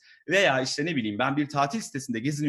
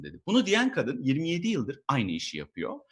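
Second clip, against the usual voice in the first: ordinary delivery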